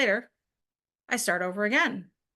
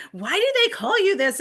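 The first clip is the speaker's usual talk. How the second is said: light imitation of a French accent, pitched higher and louder; little French accent; 11 semitones higher, 7 dB louder than usual. Wife mimics her French husband asking in a French accent. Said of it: "in his little French accent".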